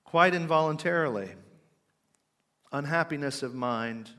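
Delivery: quoted preaching. Retold or imitated. Preacher reciting an old sermon on sorrow, emphatic declaiming. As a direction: declaim from the pulpit, phrases falling away. Reads sermon quotation aloud.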